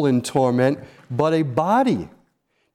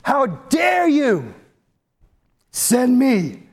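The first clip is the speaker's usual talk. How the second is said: defiant outrage; 8 semitones higher, slower than usual. Retold or imitated. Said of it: "shaking their fist at God".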